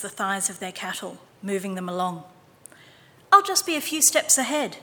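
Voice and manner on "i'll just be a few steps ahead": put-on eager boy. A narrator's reading. Boy voiced in story.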